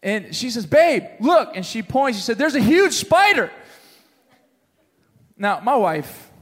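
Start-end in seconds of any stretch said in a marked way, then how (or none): 0.6-1.6 higher, louder urgent call; high mock-panicked call; mock alarm. Retold alarmed wife spotting spider. 2.4-3.5 high-pitched exaggerated alarm; hammed-up spider panic; mock panic. Retold wife exaggerating spider danger.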